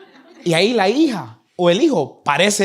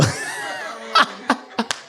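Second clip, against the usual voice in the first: breathy laugh; 7 semitones higher than usual. Real, not acted. Laughs after grandfather story.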